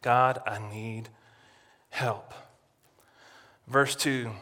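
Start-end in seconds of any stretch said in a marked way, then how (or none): 0.0-2.3 pleading prayer. Acted. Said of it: "he's looking to God".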